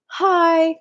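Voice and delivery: put-on high, loud child's greeting; little kid. Little kid walking in a room, high childlike greeting. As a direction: chirp it like a small child. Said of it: "little kids can go".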